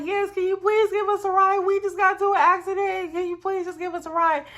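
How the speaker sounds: high-pitched urgent begging; pleading. Retold or imitated. Storyteller voices friend begging a passing driver, high pleading.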